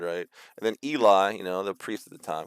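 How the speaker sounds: normal talking voice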